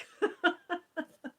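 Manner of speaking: high-pitched real giggle; a run of high little laughs. Real, not acted. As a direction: giggle at your own remark. Chuckles at her own remark.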